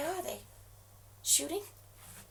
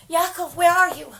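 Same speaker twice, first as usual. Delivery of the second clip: calling out; 10 dB louder than usual. Acted. Calling out over phone.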